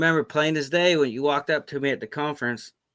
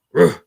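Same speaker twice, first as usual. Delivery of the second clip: short, louder growl-like "roof"; imitated growl; 6 semitones higher, 8 dB louder than usual. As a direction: imitate an animal's one-syllable growl. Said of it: "the one growls and it's like".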